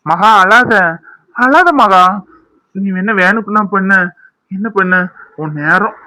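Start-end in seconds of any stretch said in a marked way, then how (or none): 0.1-2.1 calm her loudly, urgently; urgent comforting. Acted. Husband consoles crying wife. (அழாதே என்று பதற்றமாக ஆறுதல்)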